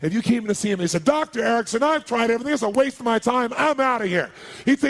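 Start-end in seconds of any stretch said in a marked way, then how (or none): 1.0-4.3 high, loud, fed-up client; frustrated patient. Acted. Patient complaining to his doctor, frustrated. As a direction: rattle it out louder, exasperated and giving up.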